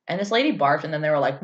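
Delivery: usual speaking voice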